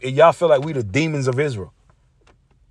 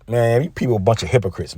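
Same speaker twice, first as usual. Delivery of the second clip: normal talking voice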